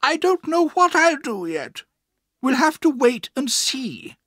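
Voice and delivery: high, loud, firm aunt; no-nonsense refusal to decide yet. A narrator's reading. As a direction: answer firmly up high, slowing at the end. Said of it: "she answered firmly".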